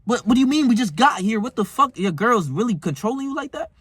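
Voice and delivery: can't believe he's leaving already; incredulous. Retold or imitated. To a friend who leaves when his girl calls, incredulous. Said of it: "I'm like".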